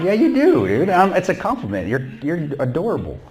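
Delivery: high, mock-sweet teasing voice. Teasing interpreter Henry about looking like Newhart, high mock-sweet voice. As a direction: coo at him like he's cute.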